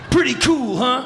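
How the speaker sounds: high, loud show-off voice. Retold or imitated. Rock singer showing off his beer trick, high and loud. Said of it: "He's like".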